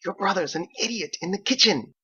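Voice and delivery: high mother's barely hushed aside; mock aside. Mother gossiping to her son about his brother, barely hushed. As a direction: pretend to whisper but don't really. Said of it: "lowered her voice hardly at all".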